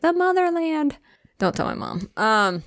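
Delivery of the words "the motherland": high, hammed-up mom voice; imitated mother. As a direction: mimic mom proclaiming it, pitched way up. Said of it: "So she's like".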